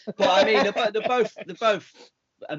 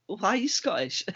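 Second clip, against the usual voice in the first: retold bafflement; 6 semitones higher than usual. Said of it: "I was like".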